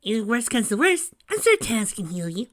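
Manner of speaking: put-on high, loud kid voice; nervous kid sidekick. A narrator's reading. Tails reassures the groom about tonight, nervous. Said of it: "Tails said with a nervous chuckle".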